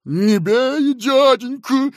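high, loud begging; cowering plea. Acted. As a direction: flinch and beg not to be hit. Fat man cowering.

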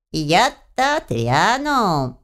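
slow, creaking character voice; creaky house spirit. A narrator's reading. House spirit as living alarm clock, creaky retort. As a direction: creak it out, stretching the words. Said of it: "проскрипел желтоглазый будильник".

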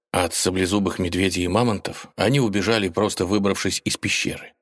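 usual speaking voice

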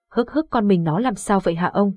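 ordinary delivery

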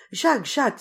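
high, loud cry of alarm; frightened cry. A narrator's reading. Geneviève cries out to Jacques, frightened. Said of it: "s'écria Geneviève effrayée".

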